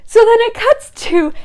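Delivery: ordinary talk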